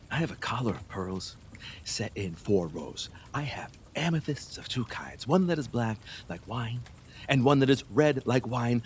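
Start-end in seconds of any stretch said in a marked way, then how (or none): 0.1-6.8 low, soft, seductive listing; hushed tempting. Acted. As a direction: lure her with low murmurs.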